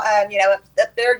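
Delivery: ordinary talk, nothing marked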